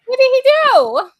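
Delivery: loud, high-pitched yell. Real, not acted. Co-host yelling out about H man. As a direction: yell it out in protest. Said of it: "don't yell at H man".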